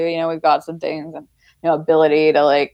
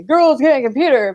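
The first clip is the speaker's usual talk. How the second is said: mocking quote; 7 semitones higher, 3 dB louder than usual. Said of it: "they already say".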